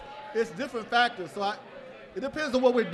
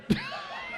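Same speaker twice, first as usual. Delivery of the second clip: high laugh; 6 dB quieter than usual. Real, not acted. Laughing at his own story.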